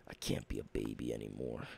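very low, fast self-pep talk; deep put-on voice. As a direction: mutter it deep and quick to psych yourself up. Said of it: "I like to go into different accents".

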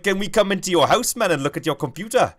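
loud, high-pitched South African impression; South African accent. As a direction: belt out a movie quote in a hammed-up South African accent. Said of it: "in the South African accent is quotes from".

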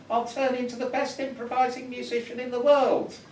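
high, loud squeaky frog greeting and bragging; a tiny frog selling itself to the wife; eager frog. Acted. Frog greeting the wife and begging a kiss, high squeak. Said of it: "the frog says".

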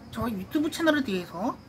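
muffled behind hand; speaking through fingers; hand over mouth. Real, not acted. Seen 4: Hand covers mouth while speaking until 1.3s, then lowered.

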